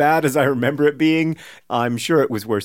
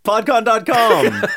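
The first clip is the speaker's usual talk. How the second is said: slow, high, laughing pitch; laughing mock plug; 8 semitones higher, 4 dB louder, slower than usual. Plugging PodCon as a joke, slow laughing announcer. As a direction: announce the website through laughter.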